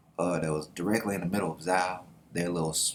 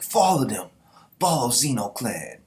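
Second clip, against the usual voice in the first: loud, raised call to charge; rallying order; 4 semitones higher, 6 dB louder than usual. Acted. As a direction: urge the men after the clan. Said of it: "Rengyokyo is like".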